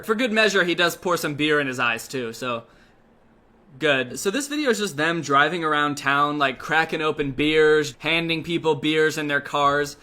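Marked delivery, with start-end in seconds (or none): none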